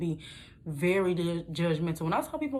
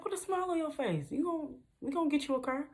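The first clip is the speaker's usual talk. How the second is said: high, softer coaxing; coaxing salesman; 8 semitones higher, 5 dB quieter than usual. Retold or imitated. Salesman coaxing her to smile, high and soft. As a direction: lightly voice the salesman.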